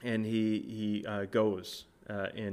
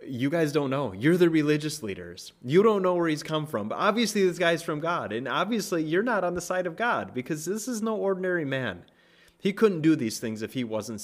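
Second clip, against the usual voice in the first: rising, louder, higher challenge to the leaders; a preacher acting out a bold reply; mock heated retort; 6 semitones higher, 5 dB louder than usual. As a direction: build the rebuke up with each line. Blind man confronts religious leaders.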